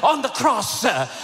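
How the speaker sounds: building to high, insistent exhortation; heated pulpit delivery, pitch climbing; fired-up preaching. Real, not acted. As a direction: work up the congregation phrase by phrase.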